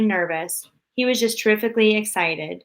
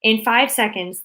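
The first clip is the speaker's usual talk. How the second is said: ordinary delivery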